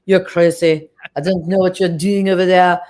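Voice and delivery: put-on French accent. French film editor telling off her writer, in a French accent. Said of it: "I love doing the French accents".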